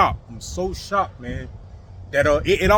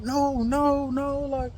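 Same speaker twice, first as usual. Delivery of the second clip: imitated screaming; 10 semitones higher, slower than usual. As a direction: mimic her wailing protest, high and drawn out. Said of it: "she's over there screaming like".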